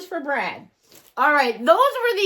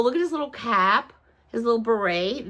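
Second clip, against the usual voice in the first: ordinary talk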